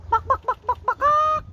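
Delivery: high clucking bak bak; hen clucking imitation. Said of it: "What does the hen say?".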